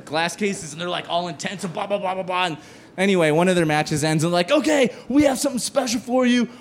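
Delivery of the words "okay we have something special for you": hyped event announcer. Retold or imitated. Host to the gaming crowd, booming hype announcer. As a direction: sell the comic like an arena announcer.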